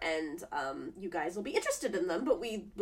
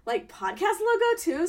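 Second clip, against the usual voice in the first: high, loud, playful pitch of an idea; eager retold request; 10 semitones higher, 9 dB louder than usual. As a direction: re-enact asking with bubbly enthusiasm. Acts out her own eager request.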